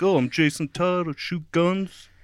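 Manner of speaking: a hint of a put-on tough guy, softly; lightly mock the tough guy; Jason Todd voice. How she hears Jason Todd talk, lightly mocking. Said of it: "that's how I read Jason Todd".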